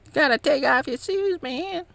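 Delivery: squeaky mock monk telling him off; angry monk. Upset monk scolding him for wearing shoes before the Buddha, high mock voice. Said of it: "He's like".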